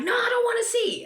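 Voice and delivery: very high, loud imitated brush-off; loud refusal. Border guard refuses to look at traveler's phone, loud refusal. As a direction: shut the offer down at full pitch.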